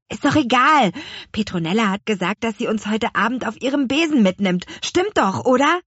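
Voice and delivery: put-on loud, high, impatient boy; eager interruption. A narrator's reading. Louis cuts his sister off, eager for the broom ride. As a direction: cut in, bursting to fly.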